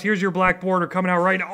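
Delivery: normal talking voice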